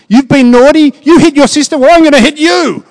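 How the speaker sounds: high, loud mock telling-off of a child; scolding parent. Preacher voicing a parent scolding a naughty child, high and loud. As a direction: play the cross parent threatening a smack.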